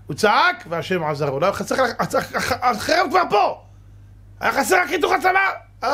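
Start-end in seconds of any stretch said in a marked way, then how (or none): 1.4-5.9 much higher, stammering into a shout; urgent shout. Acted. Preacher to congregation about the king's rescue, shouting with urgency. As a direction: hammer home that the sword is already there. Preacher acts out the sword at the neck.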